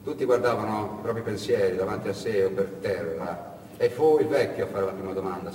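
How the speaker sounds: usual speaking voice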